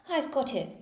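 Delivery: queen catching her shawl, triumphant; triumphant cry. A narrator's reading. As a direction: crow over catching the shawl. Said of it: "she cried in a triumphant tone".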